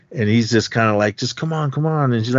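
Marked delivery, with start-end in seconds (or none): 1.2-2.1 higher-pitched imitated pleading; mock urging, voice lifted; retold coaxing. Attacker coaxing the frightened woman.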